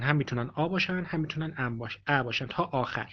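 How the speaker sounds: usual speaking voice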